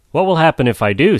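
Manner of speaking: quick, sniffy, wary question; sniffy and cautious. Acted. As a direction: ask warily, sniffing. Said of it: "most sniffily and most cautious".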